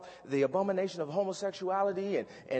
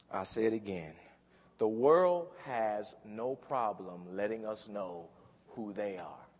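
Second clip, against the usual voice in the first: ordinary talk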